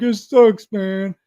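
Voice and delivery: high, loud mock whining; imitated complaining. Voices the guys complaining about PT.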